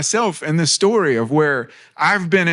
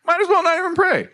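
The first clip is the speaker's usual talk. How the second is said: much higher, fast, put-on whiny voice as the outdone believer; mock insecure churchgoer; 9 semitones higher, faster than usual. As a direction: rush it out, squeaky and self-pitying. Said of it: "And you're like".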